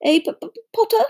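high, jittery stuttering professor; nervous stammer. A narrator's reading. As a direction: stutter into an uneasy little joke. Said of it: "He laughed nervously".

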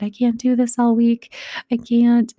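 put-on a worn-out kid at her limit; devastated child. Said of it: "she was just devastated".

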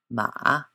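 "ma" dipping then lifting; third tone. Shown as a demonstration. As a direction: scoop "ma" low and back up. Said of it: "a dipping note".

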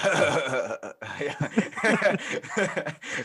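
a lightly imitated wheezy chuckle; Seth Rogen laugh. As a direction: mimic a raspy stoner chuckle. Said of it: "My Seth Rogen laughs like that".

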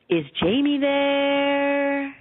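put-on slow, faded, lightly French-accented man, stretching out "there"; a hint of a French husband calling slowly from far away; ghostly French accent, "there" drawn out long. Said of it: "the voice had an accent, and he extended the syllable "there".

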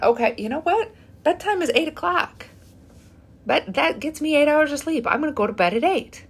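firmly declaring a new rule; resolved. Retold or imitated. Telling herself bedtime is eight, decided and upbeat. Retelling her own decision.

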